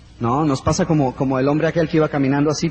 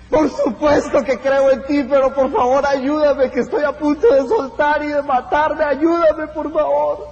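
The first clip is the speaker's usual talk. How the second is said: much higher, strained, begging voice; pleading for help; 8 semitones higher than usual. Acted. Hanging man begs the voice above, desperate and high. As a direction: beg desperately, almost out of strength.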